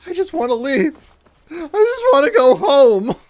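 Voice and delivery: high, tearful whine; whiny wail. Real, not acted. Streamer whining high at the game, wanting to quit. Whining after dragon attack.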